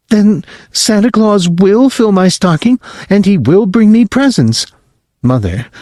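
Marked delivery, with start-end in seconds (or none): 0.1-4.6 put-on high child's voice, pleading; little boy. Whipping boy asking his parents if he is bad, high child voice. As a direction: squeak it out like a worried small boy. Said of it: "Whipping boy".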